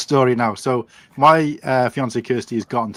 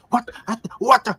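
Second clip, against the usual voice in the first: startled outburst; 11 semitones higher than usual. Retold or imitated. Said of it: "he just bangs into".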